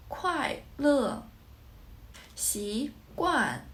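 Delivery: "快乐" falling on each syllable, starting high; fourth tone. Shown as a demonstration. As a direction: drop sharply on "快", "乐" and "惯". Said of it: "are both fourth tone".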